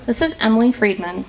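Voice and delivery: a light, hushed public radio reporter sign-off; lightly voiced female NPR host, measured and calm; NPR reporter impression. Radio host mimicked for callers, lightly voiced. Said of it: "female N P R voice impression".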